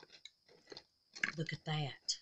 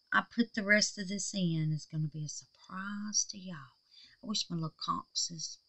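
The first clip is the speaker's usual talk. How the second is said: whisper; 5 dB quieter than usual. Real, not acted. Whispering a surprise to viewers.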